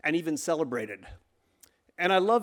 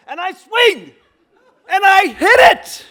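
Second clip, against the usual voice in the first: loud high triumphant shout; excited; 14 dB louder than usual. Real, not acted. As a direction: yell it like you just won the game. Big swing in the story.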